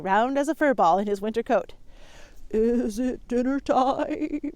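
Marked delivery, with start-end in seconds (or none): 2.5-4.5 very slow bleating sheep call; sheep bellow. Acted. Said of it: "he bellowed".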